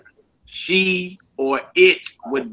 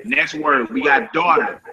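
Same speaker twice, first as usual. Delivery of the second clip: ordinary talk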